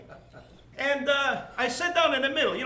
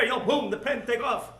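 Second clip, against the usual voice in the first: ordinary delivery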